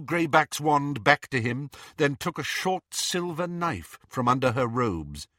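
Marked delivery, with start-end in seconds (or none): none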